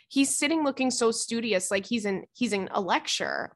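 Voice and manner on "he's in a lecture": ordinary delivery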